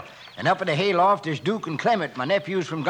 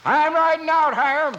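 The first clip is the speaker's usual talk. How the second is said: higher, louder shout across the yard; calling out; 12 semitones higher, 4 dB louder than usual. Acted. Rancher calling out to Hiram Moore, loud. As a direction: shout out to the rider.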